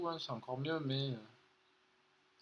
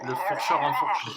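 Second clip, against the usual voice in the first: laughing; 2 semitones higher, 9 dB louder than usual. Real, not acted. Joking about the fork sound.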